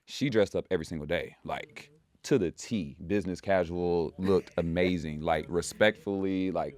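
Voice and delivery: ordinary talk, nothing marked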